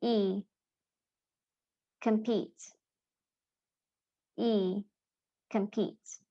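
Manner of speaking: long "E" alone, then "Compete" stressed on that vowel. Shown as a demonstration. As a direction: model "Compete" as "kum-PEET", sound first. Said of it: "how these words are supposed to be pronounced".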